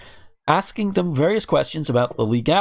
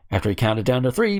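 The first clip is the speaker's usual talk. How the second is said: ordinary talk, nothing marked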